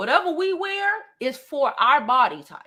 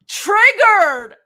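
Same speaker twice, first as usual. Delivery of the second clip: high, loud sing-song taunt; 7 semitones higher, 10 dB louder than usual. Real, not acted. Streamer taunting the upset commenters, loud and mocking. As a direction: rub it in.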